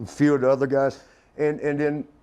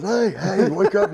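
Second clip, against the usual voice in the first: higher, insistent calling; mock urgent rousing; 6 semitones higher, 3 dB louder than usual. Tall dude waking his friend on the plane, urgent mock call. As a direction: call out to wake someone up.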